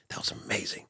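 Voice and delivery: whispered praise in his ear; hushed, leaning in close; a boss whispering congratulations. Retold or imitated. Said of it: "grabs me by the head and goes".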